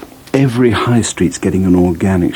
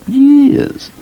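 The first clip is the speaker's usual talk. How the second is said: years flung out much higher and louder; emphatic repeat; 3 dB louder than usual. Acted. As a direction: hit years hard on the repeat. Hippie dealer insists he avoids sprouts.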